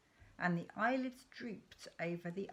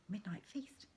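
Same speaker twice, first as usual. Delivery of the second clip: softer, breathy secret whisper; 8 dB quieter than usual. Acted. Father tempting his son with a secret snack, whispered. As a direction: let it slip out as a conspiratorial whisper. Dad proposes a secret feast.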